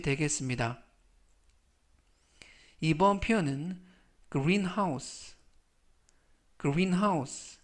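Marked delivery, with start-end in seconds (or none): 4.3-7.6 greenhouse said clearly twice, falling; model pronunciation. Shown as a demonstration. Teacher modelling greenhouse for the class, careful and clear. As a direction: say greenhouse slowly for learners. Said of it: "greenhouse라고 발음하시면 되겠고요".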